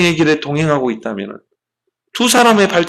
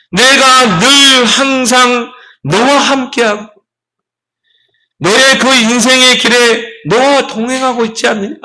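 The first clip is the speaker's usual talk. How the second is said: higher, louder, very slow divine call; voice of Jesus; 6 semitones higher, 3 dB louder, slower than usual. Acted. Jesus answers the complaining walker, slow and higher. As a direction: speak as Jesus, calling out over the snow.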